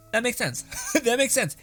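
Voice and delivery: high-pitched, amused approval; mock-impressed agreement. Viewer reacting to reincarnated Demon King, mock-impressed. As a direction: pitch it up high with a laugh between repeats.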